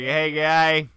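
loud mock provocation. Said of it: "and you're like".

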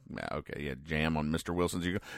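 low, soft mumble to oneself; muttered aside. Real, not acted. Host going back over Dennis's ukulele line, muttering to himself. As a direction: mull it over under your breath.

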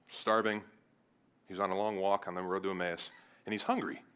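ordinary talk, nothing marked

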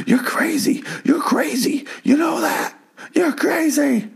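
hushed whisper-yell, imitating an unhinged man; mock freak-out. Retelling a man's Twilight Zone freak-out to the bartender, strained whisper-yell. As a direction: shout it under your breath, hammed-up. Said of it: "Twilight Zone-iest freak out I've ever seen".

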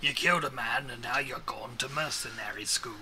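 lightly put-on Australian accent; a hint of Aussie twang. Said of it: "I turned Australian".

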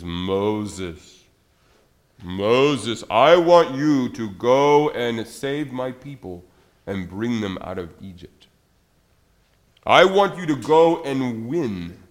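slow, solemn calling from the bush; God's voice. Acted. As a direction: call Moses slowly and gravely. God speaks from burning bush.

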